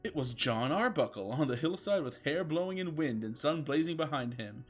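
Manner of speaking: normal talking voice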